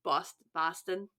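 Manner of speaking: slow trial of a Boston accent. Shown as a demonstration. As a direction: try out Boston, Boston accent style. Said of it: "how to pronounce Boston in a Boston accent".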